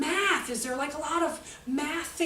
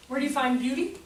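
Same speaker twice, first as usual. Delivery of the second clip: ordinary talk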